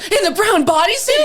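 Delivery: high, loud and laughing, eager to confirm; eager guy. Retold or imitated. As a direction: blurt it out, thrilled she's asking. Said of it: "and he goes".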